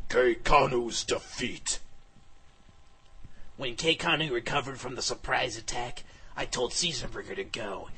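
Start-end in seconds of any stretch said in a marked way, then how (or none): 0.1-1.8 slow, drawn-out chapter title; title announcement. A narrator's reading. Narrator announcing the villain's defeat, title announcement.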